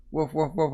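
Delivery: high spoken woofs; mock dog barking. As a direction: imitate a yapping dog lightly. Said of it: "and the dog's barking".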